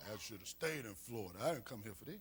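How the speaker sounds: low, sulky complaint; lightly voiced grumble; grumbling believer. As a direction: mutter like a disappointed churchgoer.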